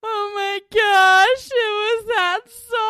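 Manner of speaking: high, slow, drawn-out swoon; imitating mom. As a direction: gush like a mom who just recognized the melody. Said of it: "my mom is just like".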